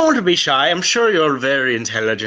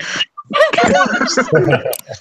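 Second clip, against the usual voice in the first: high burst of laughter at own slip; breaking character laughing; 4 dB louder than usual. Real, not acted. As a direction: laugh at the flubbed accent.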